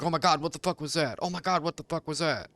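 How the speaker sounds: lightly voiced dog, mock panicked; panicky dog voice. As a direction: play the dog freaking out over a noise.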